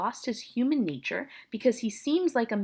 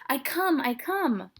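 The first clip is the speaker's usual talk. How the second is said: higher-pitched, acted call to the door; harried calling out; 7 semitones higher, slower than usual. Friar answering insistent knocking, harried call. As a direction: put the knocker off, a bit harried.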